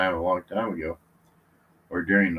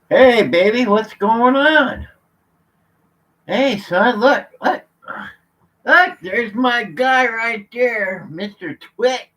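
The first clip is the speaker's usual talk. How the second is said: high, slow sing-song doggy talk; 12 semitones higher, 10 dB louder, slower than usual. Real, not acted. Owner greets his dog in high, slow baby talk.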